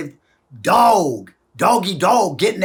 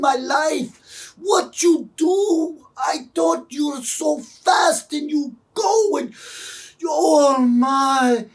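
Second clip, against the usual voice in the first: high, slow, overcome dad voice; tearful, imitated; 9 semitones higher, slower than usual. Dad overcome after his child's game, imitated tearful gushing. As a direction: imitate a father wailing with emotion, drawn out and high. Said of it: "He started welling up with tears".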